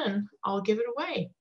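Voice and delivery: usual speaking voice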